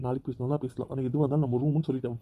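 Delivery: normal talking voice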